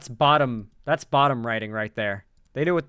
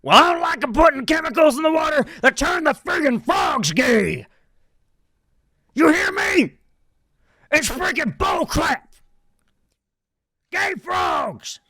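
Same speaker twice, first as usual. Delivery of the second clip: very high, loud, ranting shout in a put-on voice; Alex Jones impression; 13 semitones higher, 6 dB louder than usual. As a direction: rant like a conspiracy radio host, shouting in a high strained voice. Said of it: "my Alex Jones voice?".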